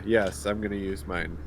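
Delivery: normal talking voice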